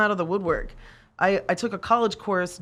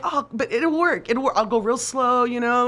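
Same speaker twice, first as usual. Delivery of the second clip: higher, eager wheedling; mock pleading; 5 semitones higher, 3 dB louder than usual. Student begging the instructor to let her weld, higher and pleading. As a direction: coax him like a pushy student.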